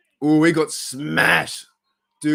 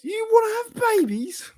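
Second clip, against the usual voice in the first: squeaky voice, much higher, rising in mock shock; high falsetto disbelief. Fan quoting a comedy skit, squeaky high shocked question. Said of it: "And he's like".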